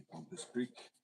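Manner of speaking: high, slow pompous French drawl on pompous; mock French accent. Mocking how French would sound, snooty French accent.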